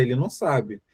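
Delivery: usual speaking voice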